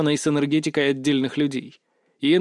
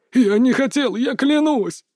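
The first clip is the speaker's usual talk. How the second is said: sobbing plea; 8 semitones higher than usual. A narrator's reading. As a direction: wail it out in tears. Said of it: "Пётр рыдал".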